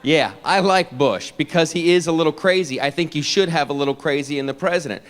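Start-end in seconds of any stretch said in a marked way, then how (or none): none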